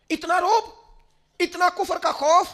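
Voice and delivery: high impassioned rebuke, stretched slowly; indignant cry. Acted. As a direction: lash out at the fear of the unbelievers. Preacher scolding the Muslims.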